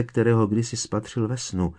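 ordinary delivery, nothing marked

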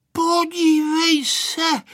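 high, drawn-out call; excited child's call. A narrator's reading. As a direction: call out to a friend, high and slow. Pepíček points out the plane. (vzrušené dětské zvolání)

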